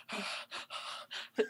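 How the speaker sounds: dramatic breathy gasps; mock-distraught heavy breathing; heavy panting breaths. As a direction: huff like a wounded wrestler. Imitates wrestler after the slam.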